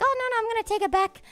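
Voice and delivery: high and fast brush-off; quickly fobbing off the nosy driver; hasty dodge. Retold or imitated.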